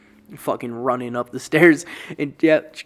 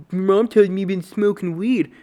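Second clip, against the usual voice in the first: mock cop voice; 6 semitones higher, 5 dB louder than usual. Cop questions the teen.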